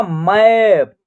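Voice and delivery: "मैप" with a stretched open 'ae' vowel; teacher's model "मैप", A as a long 'ae'; open 'ai' sound. Shown as a demonstration. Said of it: "ए का उच्चारण ऐ हो रहा".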